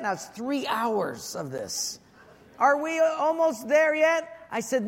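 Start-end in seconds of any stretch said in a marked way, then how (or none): 2.6-4.3 put-on higher, louder whiny child; nagging kid. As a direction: whine it like a bored little kid. Kids nagging again.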